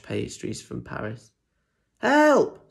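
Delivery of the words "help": much higher, loud cry for help; panicked dog. Acted. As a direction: yelp for help. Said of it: "said Bob".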